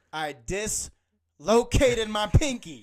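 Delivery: slow, loud, drawn-out declaration; mock-grave telling the nurse; dramatic announcement. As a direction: announce it like a big deal. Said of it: "And I said".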